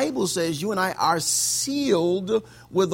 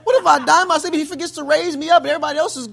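high, pleading, half-laughing panic; fearful young believer; 10 semitones higher, 7 dB louder than usual. Retold or imitated. Young believer voicing his rapture fear, mock panicked. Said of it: "I was like".

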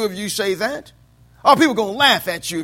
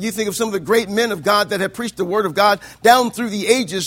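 normal talking voice

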